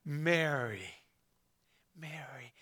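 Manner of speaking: Mary spoken very softly and gently, dropping away; tender naming. Acted. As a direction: call her name with quiet tenderness, as Jesus. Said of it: "it's not until he says her name".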